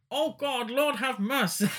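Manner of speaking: high-pitched, hammed-up American cry; terrible American accent. Podcaster quotes the guy in the film's flashback, terrible American accent. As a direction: wail it in a badly faked American accent. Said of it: "That was a terrible American accent".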